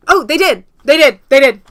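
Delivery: loud triumphant cheer; loud gleeful victory cry; triumphant shout. Real, not acted. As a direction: crow with delight at being right. Finds she was right.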